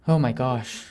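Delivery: usual speaking voice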